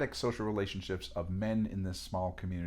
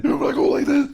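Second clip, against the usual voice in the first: gasping inhaled voice; ingressive speech; 15 dB louder than usual. Shown as a demonstration. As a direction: talk while breathing in, high and strained. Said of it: "It's when you talk while inhaling".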